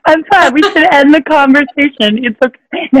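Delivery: teary laugh, voice high; laughing through tears. Real, not acted. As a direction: laugh while choking up. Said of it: "You made me tear".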